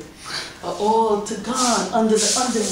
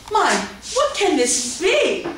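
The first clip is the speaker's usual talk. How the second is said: surprised; 8 semitones higher, 3 dB louder than usual. Acted. Persephone startled by noise.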